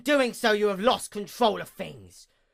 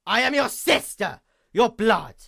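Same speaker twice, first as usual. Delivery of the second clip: anguished outburst; 6 semitones higher, 9 dB louder than usual. Acted. Accusing outburst at sister.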